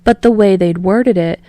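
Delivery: usual speaking voice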